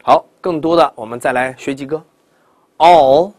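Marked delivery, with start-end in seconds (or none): 2.8-3.3 all drawn out and loud into a dark L; dark lateral L. Shown as a demonstration. Teacher sets up contrast for learners, long dark L. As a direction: press the tongue up at the end of all. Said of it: "舌侧音".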